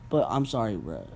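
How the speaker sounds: ordinary delivery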